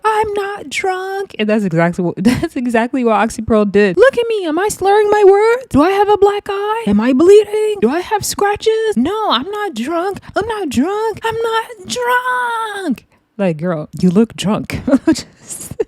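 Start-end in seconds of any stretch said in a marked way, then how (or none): 0.0-1.3 high, slow mock huffy denial; mock angry denial. A drunk person told they're drunk, high slow mock denial. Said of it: "they will get so angry". 4.0-13.1 mock drunk rant. Oxy Pearl insisting she's not drunk.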